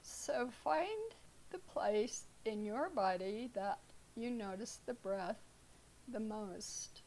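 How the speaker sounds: calm, unhurried meditation guide with long pauses; guided meditation. Real, not acted. Teacher leading students through breath awareness, guided meditation. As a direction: ease them into stillness, leaving space between phrases.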